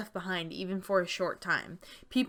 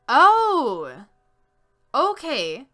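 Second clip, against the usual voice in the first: surprised exclamation; 6 semitones higher, 11 dB louder, much slower than usual. Real, not acted. Reacts to game message.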